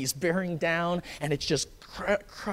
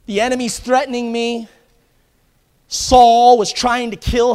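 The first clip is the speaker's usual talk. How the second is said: loud, rising preacher's cry; preaching at full force; 7 semitones higher, 15 dB louder than usual. Acted. Preacher crying out to the congregation in full force. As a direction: preach it hard, building to a shout.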